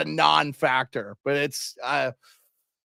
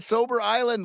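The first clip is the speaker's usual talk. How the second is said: ordinary talk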